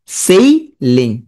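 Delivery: সেইলিং split slowly as "sei ... ling"; spelling pronunciation. Shown as a demonstration. Teacher flagging a misspelt word, slow split সেইলিং. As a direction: sound the written i in সেইলিং, pausing mid-word. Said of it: "আই ভুল করে ফেলি".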